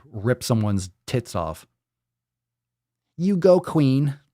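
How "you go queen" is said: slow, loud, high mock cheer; mocking sassy cheer. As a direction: drag it out like a hype girl. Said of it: "If I hear king and queen".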